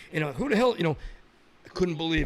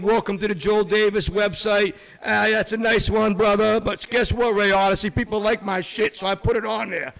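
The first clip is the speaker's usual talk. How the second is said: loud brash promo voice, louder all through; boastful wrestler promo, louder and higher; wrestling trash talk; 3 semitones higher, 4 dB louder than usual. Acted. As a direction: cut a promo on your rival, brother.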